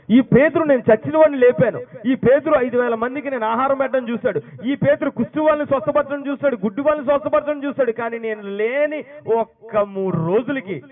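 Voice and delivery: loud, high, impassioned voice of Jesus; fervent preaching as Jesus. Acted. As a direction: proclaim it from the pulpit with fire. Preacher voices Jesus about Peter. (ఉద్రేకంతో బిగ్గరగా ప్రసంగం)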